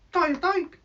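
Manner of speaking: cheeky bird puppet voice; squeaky puppet bird. Acted.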